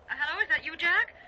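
high, anxious, rising question; upset. Acted. Wife anxiously reaching her husband by phone, upset. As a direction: ask urgently, voice climbing. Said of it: "Wh-, what's upset you?".

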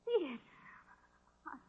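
normal talking voice

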